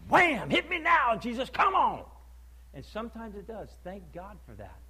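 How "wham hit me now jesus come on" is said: impatient believer. Retold or imitated. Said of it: "we want it to go".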